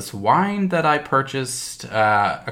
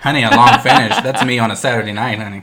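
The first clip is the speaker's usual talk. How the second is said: sassy; 9 dB louder, much faster than usual. Real, not acted. Sassy joke about wine finish.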